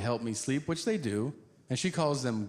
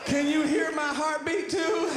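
much higher, louder, laughing panic; panicked squeal; 14 semitones higher, 4 dB louder than usual. Retold or imitated. High comic asking a stranger about his heartbeat, squealing.

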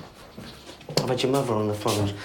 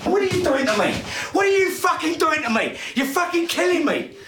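high, full-throated furious tirade; out-of-control yelling, pitched right up; enraged shouting; 7 semitones higher than usual. Acted. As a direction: scream it at your son in a rage. Father's violent rage at his son continues.

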